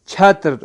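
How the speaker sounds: چھتر with a breathy aspirated ch, loud; Persian pronunciation. Shown as a demonstration. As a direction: say چھتر loud, puffing air on the first ch. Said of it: "فارسی میں کیا کہا جاتا ہے". (چھتر فارسی تلفظ میں، زوردار ہوا والی چھ کے ساتھ)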